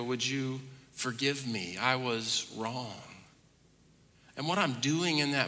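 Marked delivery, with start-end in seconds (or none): 0.0-3.0 humble apology. Retold or imitated. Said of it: "look at one of the kids and go".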